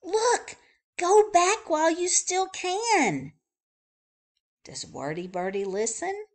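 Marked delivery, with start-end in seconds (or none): 0.0-3.3 high, loud pleading shout; urgent warning. Acted. Rabbit rushing in to warn Wordy Birdie, high and loud. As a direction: warn her to turn back. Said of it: "Rabbit dashes onto the scene".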